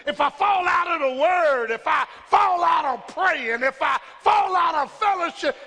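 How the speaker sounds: building, shouted pulpit cadence; full-force preaching. Real, not acted. Preacher testifying that God will find him, full-force preaching. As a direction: drive each clause up into a held cry.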